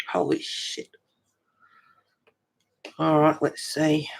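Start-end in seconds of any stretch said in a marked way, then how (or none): 0.1-0.9 whispered shock. Real, not acted. Fire just up the road.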